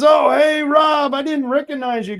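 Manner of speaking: a loud, high burst of sudden recognition; surprised recognition. Retold or imitated. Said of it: "he looks up to me and he goes".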